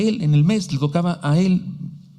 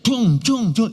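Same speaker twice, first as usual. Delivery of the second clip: video game noise; 6 semitones higher, much slower than usual. Retold or imitated. Preacher mocking the teenager's late-night games, playful blips. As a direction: chirp out a silly game blip. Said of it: "tus mugres ruiditos".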